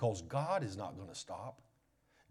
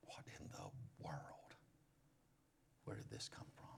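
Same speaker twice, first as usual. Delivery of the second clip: soft whispered shock; dazed whisper; stunned whisper; 9 semitones lower, 19 dB quieter than usual. Acted. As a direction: whisper it, knocked breathless. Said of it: "and you're like".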